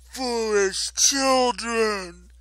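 loud, high, slow proclamation; defiant goddess. A narrator's reading. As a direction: boom it out slowly as a furious goddess. Enraged goddess scolds attackers.

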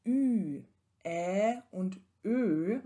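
Ä und Ö slow, held long single vowels; long vowel demo. Shown as a demonstration. Lecturer holds the long vowels slowly for students. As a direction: stretch each vowel out. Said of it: "als lange Monophthonge zu sprechen".

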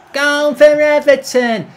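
high, loud, drawn-out mocking announcer; annoying announcer voice. Player mocking the game's announcer after a goal, annoying high voice. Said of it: "You've got a really annoying voice".

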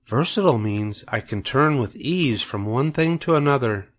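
a gentle hint of a wise spider, hesitant; Charlotte the spider.

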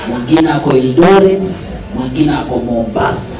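normal talking voice

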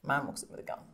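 quiet, unvoiced mulling; whispered realisation. Writer quietly repeats Keith's advice to herself in a whisper. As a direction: whisper it to yourself as you get it. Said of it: "So I was like".

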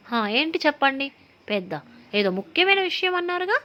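showy, high-pitched mock importance; hammed-up posing; putting on airs. A narrator's reading. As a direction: act grand and a bit haughty. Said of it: "pose కొడుతూ".

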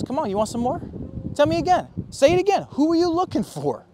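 high, loud, cocky challenge; taunting Jesus. Retold or imitated. Jesus daring the knocked-down mob, loud high taunting. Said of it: "Jesus knocks these guys down and goes".

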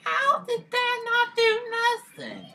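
mock squeaky disbelief; squeaky high voice. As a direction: squeal it like a cartoon. Squeaky voice mocking failure.

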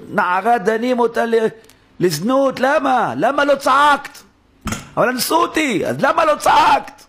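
high, forceful courtroom-style questioning; loud challenge. Acted. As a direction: demand an answer, voice pushed high and drawn out. Acted trial of the girl, challenging her.

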